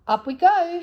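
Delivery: joyful loud shout, pitched high. A narrator's reading. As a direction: call out happily as the basket rises. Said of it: "shouted Joe".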